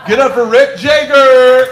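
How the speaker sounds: host hyping the crowd for the pharmacist; host intro shout. Real, not acted. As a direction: bring the next act up big. Host introducing next comic.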